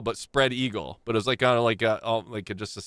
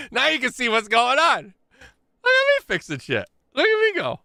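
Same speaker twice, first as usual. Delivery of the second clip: excited; 11 semitones higher, 4 dB louder than usual. Real, not acted. Bragging about fixing the blur.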